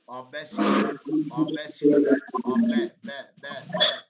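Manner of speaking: ओबेसिटी broken into slow, loud syllables ओबे-, बे-; syllable dictation. Shown as a demonstration. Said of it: "अब spelling आ जाओ".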